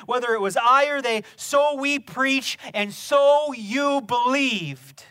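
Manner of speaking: loud, rising fervent preaching; full-force preaching. Acted. Pastor preaching Paul's words to the church, fervent and loud. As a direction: preach it with mounting fire.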